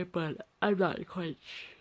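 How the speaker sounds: words pulled out through a yawn. Real, not acted. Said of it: "I'm yawning again".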